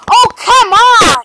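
loud, high cry of frustration; drawn-out howl at the screen; frustrated shout. Real, not acted. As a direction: yell at the game as you fall. Gamer fails a jump.

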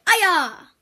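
loud high kiai; karate shout. Shown as a demonstration. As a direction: yell it like a strike. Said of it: "you say "Aya!".

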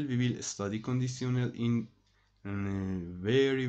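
usual speaking voice

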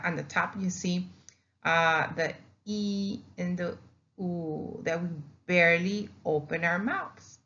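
ordinary delivery

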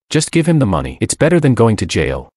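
ordinary delivery, nothing marked

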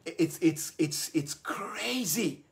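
slow breathless whisper; awed whisper. Real, not acted. Host whispering to viewers, awed and slow. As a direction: hush it out like a secret wonder. Excited about the science.

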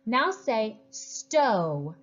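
slow phonics prompt to second graders; slow, clear teacher prompt. Shown as a demonstration. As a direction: say stow very slowly. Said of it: "say another word".

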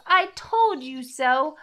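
slow sing-song told-you-so; gloating. A narrator's reading. Mouse proves itself to Gruffalo, lilting and smug.

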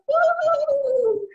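high-pitched ghostly woo; Mama Ghost wail. Retold or imitated. Mama Ghost haunts the house, high wail. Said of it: "Mama Ghost would go".

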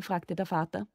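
ordinary delivery, nothing marked

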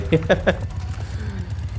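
high-pitched laugh; helpless laugh at bad luck; quick high burst of laughter. Real, not acted. Laughs at the new enemy.

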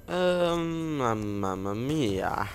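very slow, drawn-out "mamma mia"; hammed-up exclamation. Gamer clowning to himself mid-game, drawn-out exclamation. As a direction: stretch it out playfully.

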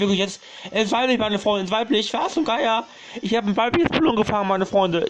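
loud, high thrilled outburst; excited. Real, not acted. Streamer tells his friends about the rare catch, thrilled. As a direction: shout the rare catch to the chat. Finds a rare female Mulung.

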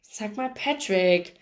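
slow, teasing grumble; mild growl. A narrator's reading. Blacksmith grumbles back at the grumpy cowboy, slowly. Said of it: "knurrte Dave".

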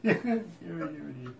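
soft, helpless laughing through the words. Real, not acted. Radio host repeating his joke's punchline, laughing.

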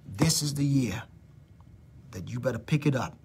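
quietly whispered, God's warning; whispered divine voice. Retold or imitated. Preacher whispering what God told her. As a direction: hush it as God speaking.

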